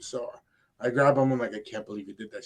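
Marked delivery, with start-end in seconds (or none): 1.5-2.4 hushed disbelief. Retold or imitated. Quietly scolding daughter.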